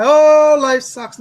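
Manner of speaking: loud, high, hammed-up wail; mock crying. Host mocking people who sit and cry, wailing. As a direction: moan it like a pretend crybaby. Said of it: "Do you sit and cry".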